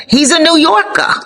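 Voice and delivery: high, New York accent on "He's a New Yorker"; playful New York accent, sung up high. Shown as a demonstration. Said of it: "sorry for the accent".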